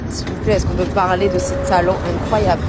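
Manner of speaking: loud, gushing excitement; excited. Real, not acted. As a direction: rave about them loudly. Gushing about the heels.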